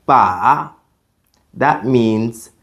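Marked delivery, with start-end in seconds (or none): 0.1-0.7 dipping "ba"; "ba" with a dip and a rise; third tone. Shown as a demonstration. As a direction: say "ba" going down, then up. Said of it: "For the third tone".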